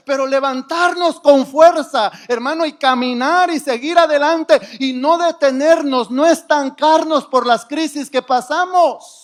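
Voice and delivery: loud, rousing exhortation; rally the congregation to get up and move on; preaching at full force. Real, not acted. (predicación enérgica y fuerte)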